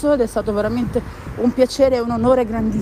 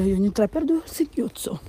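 usual speaking voice

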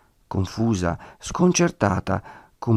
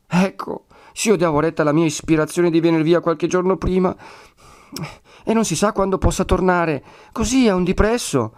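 high-pitched, regretful woman's voice; distressed Agnese; 9 semitones higher than usual. A narrator's reading. Woman regretting she came too late, high distressed voice. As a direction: fret in a high, plaintive voice.

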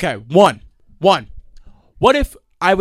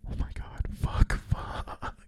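acted panicked whisper; horrified whisper; 11 dB quieter, slower than usual. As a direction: whisper it as if you just broke something. Reenacts horror after throwing her into window.

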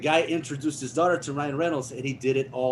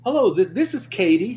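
lightly voiced host, higher and brighter-toned greeting; producer introducing; 8 semitones higher, 4 dB louder than usual. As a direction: greet warmly and a bit louder. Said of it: "So he was like".

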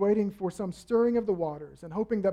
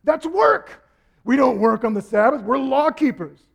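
much higher and much louder, shouting rebuke; scolding Pharisees; 8 semitones higher, 11 dB louder than usual. Acted. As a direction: bark the rules like outraged law keepers. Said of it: "They jump in and they go".

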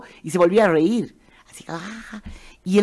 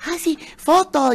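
high, slow gushing request; eager fan; 10 semitones higher, slower than usual. Retold or imitated. Fan asking the comedian, high slow eager. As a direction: beg for a photo. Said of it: "así".

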